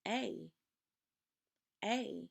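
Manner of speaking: teacher demonstrating the long vowel for the class; long A sound. Shown as a demonstration. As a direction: model A as a clean "ay". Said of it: "The long A says".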